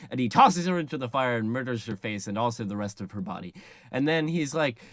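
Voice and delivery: ordinary talk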